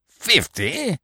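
high disbelieving cry of dismay; the hope dropping out of him; surprised cry. A narrator's reading. Sergeant hears the fugitive is fifty, high surprised cry. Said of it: "cried the sergeant".

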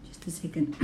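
a quick whispered aside. Real, not acted. Teacher pausing the recording for a moment, whispering. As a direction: whisper it off-mic.

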